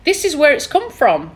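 normal talking voice

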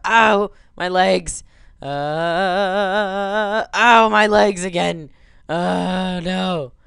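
slow hammed-up groan of pain; pained cry. Gamer hamming up pain as his ragdoll lands. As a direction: moan over the legs.

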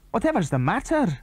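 high, alarmed question; startled. A narrator's reading. Andy to Tom, startled and high. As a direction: jump at the squeal.

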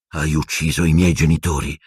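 usual speaking voice

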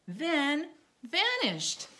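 slow dramatic. Acted. Dramatic reading.

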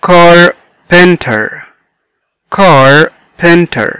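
"carpenter" very slowly in three beats; syllable by syllable. Shown as a demonstration. Teacher showing three syllables, "carpenter" slowly broken up. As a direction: stretch "carpenter" into car, pen, ter. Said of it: "متكونة من three syllables".

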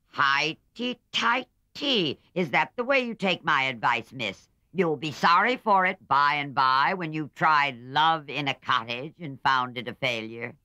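put-on an old aunt scoffing at a girl; loud, high scornful old lady; scolding old aunt. A narrator's reading.

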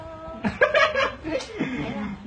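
real breathless laughter; helpless laughter. Real, not acted. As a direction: dissolve into quiet giggles. Laughing as listener keeps misunderstanding.

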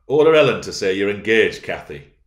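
loud, insistent urging; forceful insistence; persistent. A narrator's reading. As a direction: push the demand hard, then drop on the name. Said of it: "he persisted".

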